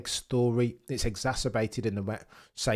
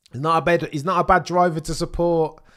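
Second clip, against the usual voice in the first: emphatic; 8 semitones higher, 11 dB louder than usual. Real, not acted. Loud defence of Max.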